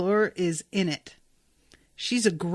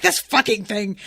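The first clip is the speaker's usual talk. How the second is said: annoyed grumble; 4 dB louder than usual. Retold or imitated. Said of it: "she gets all wrapped up in it and is just like".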